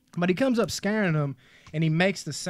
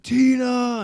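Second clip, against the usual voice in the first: calling out; 9 semitones higher, 7 dB louder than usual. Retold or imitated. Reenacting a scream for a victim.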